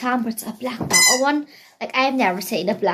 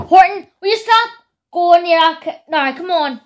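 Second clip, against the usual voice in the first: louder, higher telling-off; scolding the dog; 8 semitones higher, 7 dB louder than usual. Real, not acted. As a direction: call the dog off sharply. Scolding dog near sofa.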